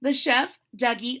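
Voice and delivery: plain reading voice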